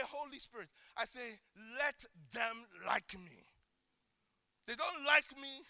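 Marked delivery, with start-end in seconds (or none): 0.1-3.4 prayer. Shown as a demonstration. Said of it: "So at times I just say".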